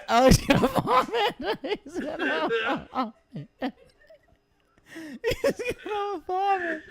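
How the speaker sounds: high, breaking into laughter; laughing. Real, not acted. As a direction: laugh through every word. Laughing at friend gagging.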